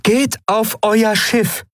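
high, slow voiced alien commander; commanding Aylan. A narrator's reading. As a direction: give a slow firm order in a high voice. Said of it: "befahl Aylan".

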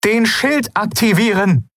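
high, drawn-out urgent order; panicked command. Acted. Aylan orders the ship to raise shields, panicked. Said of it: "Panik leuchtete in ihren Bronzeaugen".

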